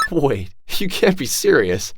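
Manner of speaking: shocked disbelief. Acted. Shocked at request to delete photo.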